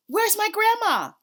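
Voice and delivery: high, loud desperate shout; yelling. A narrator's reading. Child shouting for grandma at the burning house. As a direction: scream it across the street. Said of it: "I yelled".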